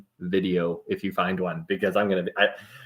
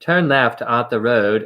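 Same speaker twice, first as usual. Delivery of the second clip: slow, loud British sat-nav line; mock British accent sat-nav; imitating British GPS voice; 6 dB louder than usual. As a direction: mimic a British GPS giving directions. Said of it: "I put my phone on a British accent".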